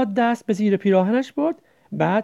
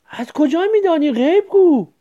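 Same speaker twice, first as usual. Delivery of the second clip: higher and louder, amazed; disbelieving surprise; 7 semitones higher, 5 dB louder than usual. A narrator's reading. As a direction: blurt it out in disbelief. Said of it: "با ناباوری پرسید".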